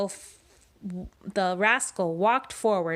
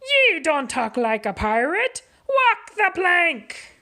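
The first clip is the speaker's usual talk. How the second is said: pirate order; 9 semitones higher, 6 dB louder than usual. Acted. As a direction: bellow the sentence at him. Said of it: "cried Pete".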